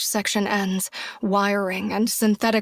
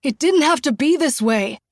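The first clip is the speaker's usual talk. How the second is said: loud, higher outburst; explosive anger; 5 semitones higher, 4 dB louder than usual. A narrator's reading. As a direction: explode at her. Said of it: "he explodes".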